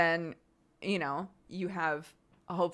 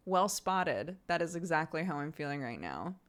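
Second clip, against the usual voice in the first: usual speaking voice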